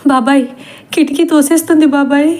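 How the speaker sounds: high, fast frightened call; girl crying to her uncle in fear; terrified. Acted. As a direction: panic as something pushes the window.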